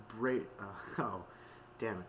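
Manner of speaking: usual speaking voice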